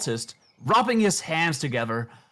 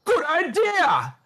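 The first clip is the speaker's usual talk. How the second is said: put-on slow, much higher old-man shout; 3 dB louder, slower than usual. Old man puppet cheering the cultist's idea, a high delighted shout. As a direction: belt it out with delight. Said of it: "the old man shouts".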